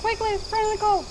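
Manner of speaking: high urgent urging. Real, not acted. Urging friend to flee.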